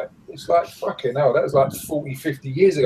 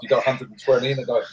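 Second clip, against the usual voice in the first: ordinary delivery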